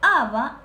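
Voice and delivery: "Aba" loud, accenting the A; firm, loud teacher's "Aba"; stress on A. Shown as a demonstration. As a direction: lean on the A in "Aba". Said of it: "lleva acento en la A".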